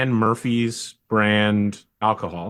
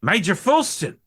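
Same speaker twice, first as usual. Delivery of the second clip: high bark of "Major!" in bogan voice; excited salute; 9 semitones higher than usual. Acted. Sergeant greeting his old major, excited high call. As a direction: snap to attention, pitched way up. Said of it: "He goes".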